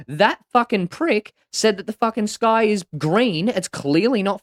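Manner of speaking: high, loud, indignant protest; exasperated tiger shouting at the injustice; outraged tiger. Acted. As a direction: plead your case in loud, high-pitched disbelief. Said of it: "And the tiger goes".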